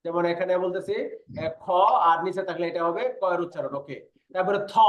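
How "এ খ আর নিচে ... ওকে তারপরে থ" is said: ordinary talk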